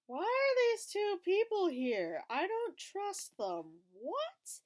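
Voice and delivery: baffled viewer questioning the screen; suspicious disbelief. Retold or imitated. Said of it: "I'm like".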